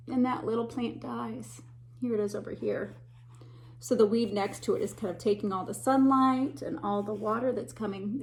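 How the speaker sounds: usual speaking voice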